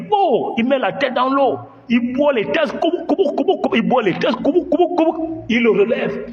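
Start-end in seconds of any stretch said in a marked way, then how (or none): 2.8-5.4 high imitated drowning gurgle; gurgling noise. Teller imitating a migrant's head dunked in the river. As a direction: mimic a head going under water.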